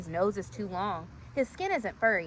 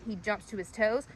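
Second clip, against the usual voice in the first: ordinary delivery, nothing marked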